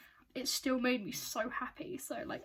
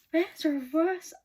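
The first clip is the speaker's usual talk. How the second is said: louder, higher burst of eager excitement; excited surprise; 5 semitones higher, 6 dB louder than usual. Real, not acted. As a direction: react with eager, loud amazement. Surprised by jacket artwork, live reaction.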